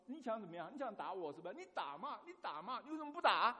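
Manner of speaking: mock taunt building to a loud, high shriek; retold wife's taunt rising to a near scream. Wife taunting husband in quarrel.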